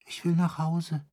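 high, trembling plea. Acted. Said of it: "mit bebender Stimme".

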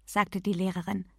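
ordinary delivery, nothing marked

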